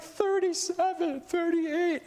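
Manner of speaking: very high, drawn-out mock wail; tearful counting. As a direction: imitate a kid counting through tears. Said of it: "has tears in his eyes".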